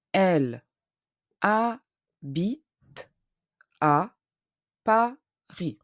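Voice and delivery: slow French, syllable by syllable with long gaps; syllabe par syllabe. Shown as a demonstration. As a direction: sound out each French syllable separately, deliberately. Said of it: "emphasize the syllables".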